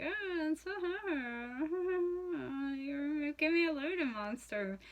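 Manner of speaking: leer and giggle like a creep; creepy smiling monster. Retold or imitated. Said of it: "being, like, all creepy, smiling".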